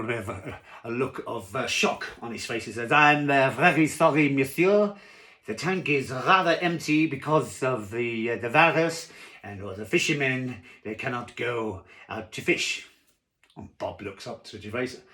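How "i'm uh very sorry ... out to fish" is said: apologetic French waiter, loud then settling; hammed-up French accent, starting high and loud. Waiter apologises to diner for empty tank, French accent. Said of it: "I can't do a French accent".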